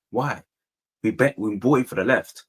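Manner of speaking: usual speaking voice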